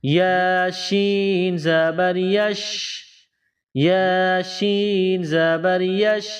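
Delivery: each syllable drawn out slowly; slow spelling drill. Shown as a demonstration. Qaida teacher spelling slowly for pupils. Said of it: "کس طرح؟".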